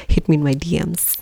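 unvoiced playful whisper. Whispering a joke invite to viewers. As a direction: breathe it like a secret.

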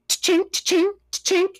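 high mouth click of a shutter; vocal camera shutter sound; camera shutter noise. Shown as a demonstration. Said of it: "That's my camera noise".

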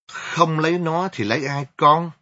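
high, loud snap back; angry retort. Acted. Angry mother retorts to her daughter, loud and high.

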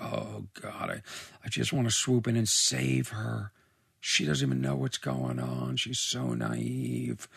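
low, soft acted sigh of concern; pitying aside. Viewer wanting to save naive girl, low and soft. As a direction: drop low and quiet, feeling for her.

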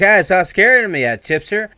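high, loud jeering call; mocking shout. Greaser jeering at Chip on the bridge, high and loud. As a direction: jeer at him loudly.

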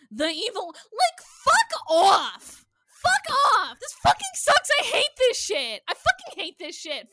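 loud, high furious shouting; furious yelling. Real, not acted. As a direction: explode with real rage. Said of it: "You got so mad, your Midwestern accent came out".

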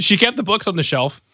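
ordinary talk, nothing marked

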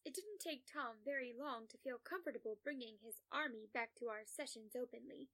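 ordinary delivery

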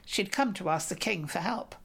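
ordinary delivery

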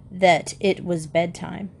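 ordinary delivery

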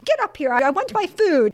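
high, impatient mock command; demanding. Diner orders hiding waiter about.

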